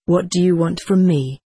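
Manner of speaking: plain reading voice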